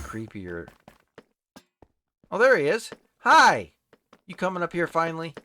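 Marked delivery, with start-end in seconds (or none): 2.3-5.3 high, loud delighted hello; excited greeting. Real, not acted. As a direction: call out happily to a returning friend. Friend finally shows up.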